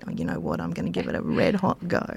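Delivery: low, soft, determined vow; defiant reply. Retold or imitated. Answering the father-in-law's doubt, low and quietly defiant. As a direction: quietly dig in your heels.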